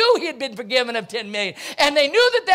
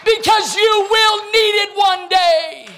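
higher, louder, fervent sermon delivery; full-force preaching; 9 semitones higher, 5 dB louder than usual. Acted. Preacher exhorts the church to show mercy, preaching hard. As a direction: drive it home to the congregation.

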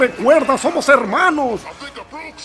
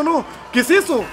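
usual speaking voice

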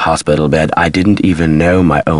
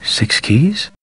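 puzzled question, rising and higher; puzzled realization; 5 semitones higher, 4 dB quieter than usual. Acted. Man talking to Nancy as a clue surfaces, puzzled. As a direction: turn a new thought into a question.

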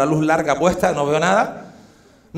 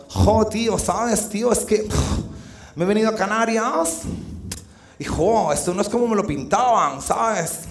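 godo girl impression; 6 dB quieter than usual. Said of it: "se puso así y dijo".

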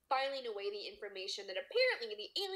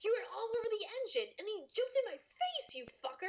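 much higher, fast, outraged rant; furious accusation; 10 semitones higher than usual. Acted. Riley accuses the alien of sabotaging his truck, fast and outraged. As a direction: rail at the thing that wrecked the truck.

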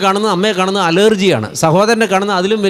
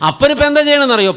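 excited rhetorical question; 10 semitones higher, 5 dB louder, faster than usual. Acted. Preacher's rhetorical climax.

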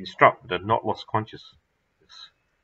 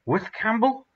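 high, rising disbelief; can't believe it, ask high; astonished question; 12 semitones higher than usual. Acted. Stunned question about the rescue.

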